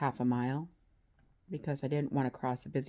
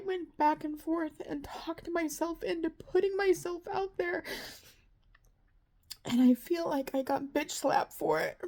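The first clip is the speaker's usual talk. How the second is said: high, tearful voice breaking as she vents; fighting back tears, voice pitched up and strained; crying; 12 semitones higher than usual. Real, not acted. Vlogger tearfully telling viewers about her rough night, crying. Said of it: "I didn't mean to get emotional".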